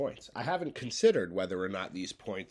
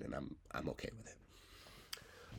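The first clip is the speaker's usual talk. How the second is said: soft whispered confession; 6 semitones lower, 14 dB quieter, slower than usual. Real, not acted. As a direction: breathe it out quietly. Hushed resigned aside.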